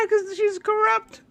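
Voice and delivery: high words breaking into laughter; can't finish for laughing; cracking up at own punchline; laughing at own joke. Real, not acted.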